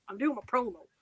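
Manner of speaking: lightly voiced A J Styles promo; cut-the-crap wrestler promo; wrestling promo. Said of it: "I'm like A J Styles".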